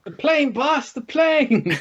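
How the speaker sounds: high, excited "the plane, boss!" impression; laughing high-pitched TV catchphrase impression; Tattoo catchphrase. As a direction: squeal it like the island sidekick spotting a plane. Mimicking Fantasy Island catchphrase.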